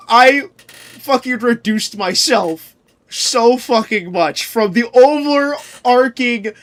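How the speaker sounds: usual speaking voice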